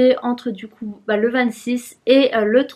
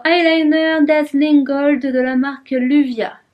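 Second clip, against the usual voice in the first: announcer; 3 semitones higher, 4 dB louder, slower than usual. Acted. Announcing product name.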